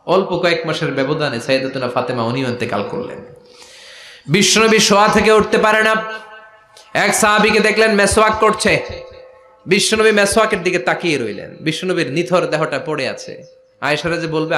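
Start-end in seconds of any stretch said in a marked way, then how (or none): 4.3-11.2 high-pitched chanted storytelling; impassioned preaching. Acted. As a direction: preach it on a raised, sustained note.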